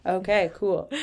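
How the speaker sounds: ordinary delivery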